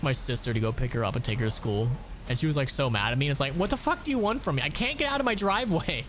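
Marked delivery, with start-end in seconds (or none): 3.5-6.0 exasperated retort. Retold or imitated. Retelling his exasperated reply to her.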